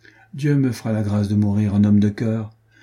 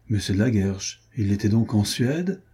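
ordinary delivery, nothing marked

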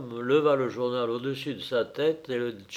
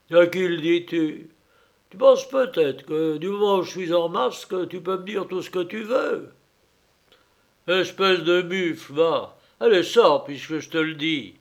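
loud, mock-fearful, then threatening; mocking masked bully; 5 semitones higher, 7 dB louder than usual. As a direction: tease then threaten them. Masked man insults and expels. (brute masquée railleuse et menaçante)

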